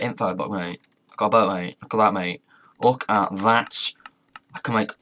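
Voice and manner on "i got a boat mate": normal talking voice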